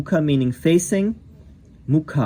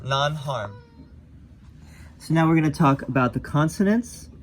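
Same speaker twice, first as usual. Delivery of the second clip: normal talking voice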